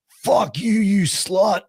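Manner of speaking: high-pitched imitation of a front-row heckler; hammed-up crowd insult; mock heckling.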